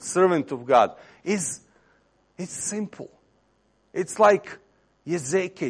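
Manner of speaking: normal talking voice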